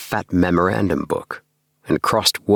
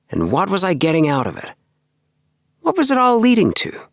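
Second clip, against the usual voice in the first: put-on young clerk, higher voice, weary of routine; a bored young man venting his drudgery; lifted voice of a disillusioned clerk; Appleby's voice; 8 semitones higher than usual. Appleby recounting his dull life.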